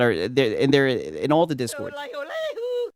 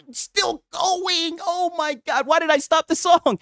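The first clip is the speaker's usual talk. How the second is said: excited shout; 13 semitones higher, slower than usual. Real, not acted. Clip keeps playing, streamer amazed.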